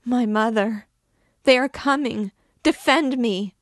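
high, loud terrified plea; panicked, anguished calling out; unspeakable distress. A narrator's reading. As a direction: cry out desperately for protection. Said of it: "in tones of unspeakable distress".

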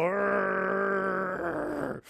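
very long, held growl; dog growl imitation. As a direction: sustain a mock old-dog growl. Imitates old dog growling at wall.

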